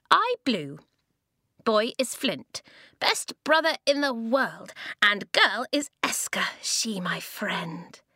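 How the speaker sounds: put-on high childlike voice, sinking to a slow whisper at the end; proud little sister naming her friends; little girl introducing. Small child introduces everyone.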